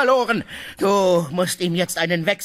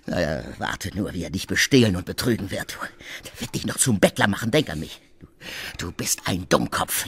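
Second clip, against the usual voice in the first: ordinary talk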